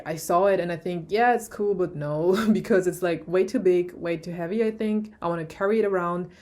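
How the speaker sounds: ordinary talk, nothing marked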